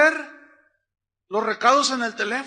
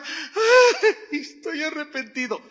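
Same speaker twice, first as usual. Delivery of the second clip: mock crying; 7 semitones higher, 4 dB louder, slower than usual.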